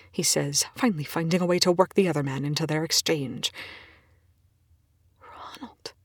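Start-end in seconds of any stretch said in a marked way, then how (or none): 5.2-5.9 very soft, breathless whisper. Acted. Colleague recalling a name, dazed whisper. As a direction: whisper the name, eyes wild. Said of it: "she whispers".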